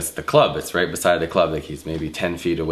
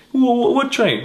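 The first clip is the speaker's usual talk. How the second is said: much higher, louder stammering shock; startled stammer; 5 dB louder than usual. Retold or imitated. Guy startled by the bouncer's threat, high and loud.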